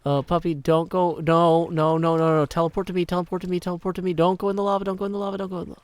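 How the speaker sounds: urgent chanted pleading, fading at the end; frantic pleading. Real, not acted. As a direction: beg the dog back from the lava in one breathless run. Frantically calling dog away from lava.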